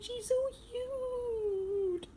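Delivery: high cooing. Real, not acted. Cooing over something cute offscreen.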